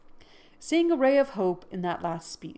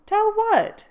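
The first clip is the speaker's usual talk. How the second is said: much higher, louder, bewildered; startled question; 10 dB louder than usual. Acted. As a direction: shoot it up in alarm. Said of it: "wondering at his violence".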